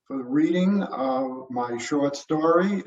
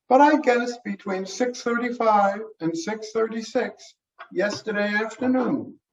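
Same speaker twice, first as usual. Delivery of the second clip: higher, brisk woman's voice; medical examiner voice; 7 semitones higher than usual. Acted. Nancy estimates time.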